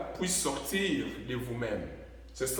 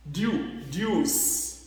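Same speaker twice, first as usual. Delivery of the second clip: ordinary talk, nothing marked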